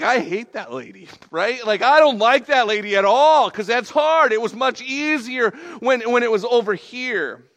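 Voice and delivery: loud, high-pitched mock complaint; worked-up, exasperated protest; comic griping. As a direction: whine loudly about the punishing trainer. Griping about trainer while running uphill.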